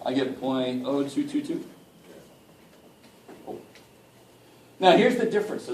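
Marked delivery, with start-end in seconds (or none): none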